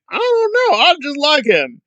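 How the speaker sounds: very high, silly helpless voice; mock naive fan. Commentator imitating people who excuse him, high mock-naive voice. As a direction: squeak it out like a clueless admirer. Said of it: "some people are just like".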